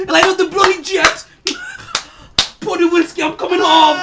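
loud, laughing Irish-style brag; hammed-up fighter yelling he's coming home; mock McGregor boast. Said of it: "McGregor on the big screen".

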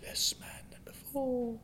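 hushed spooky whisper; whispering ghost. Pretend. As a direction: breathe it like a ghost. Playing ghost.

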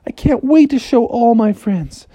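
high, drawn-out excitement; delighted Froggy. Acted. Froggy receives his prize from the house, high gushing delight. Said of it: "And Froggy said".

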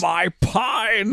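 high, drawn-out put-on wail; mock anguished cry. Co-host riffs on the fleeing aliens, mock high cry. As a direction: wail it as a joke character.

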